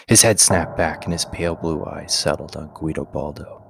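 ordinary delivery, nothing marked

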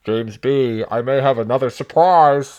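muffled voice behind a firefighter air mask; talking through a mask. Pretend. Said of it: "show the listeners what it sounds like talking through a mask".

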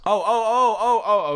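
loud, high oh of sudden understanding; sudden realization. Real, not acted. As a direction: it finally clicks, burst out. Sudden realization about taquerias.

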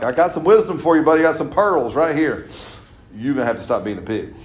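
hammed-up divine scolding; playful God voice. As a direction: tease like a fond father. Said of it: "God's like".

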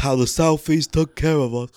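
mock old London rapper voice; lightly put-on British accent. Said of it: "What kind of accent was that?".

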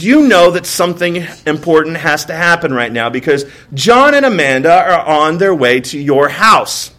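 normal talking voice

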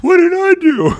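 put-on high, loud squeak of a worried little girl; worried daughter. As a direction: play the startled kid with a mock yelp. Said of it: "And she's like".

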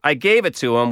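usual speaking voice

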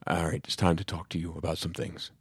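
lightly voiced dad, low and soft; a hint of a solemn, quiet dad; dad's voice. Dad opening a serious talk at dinner, in hushed dad voice. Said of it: "doing your dad's voice".

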